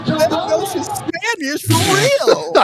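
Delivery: very high, slow squeal; hammed-up high slow squeal; high mock voice. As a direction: stretch it out in a falsetto. Exaggerated mock repeat.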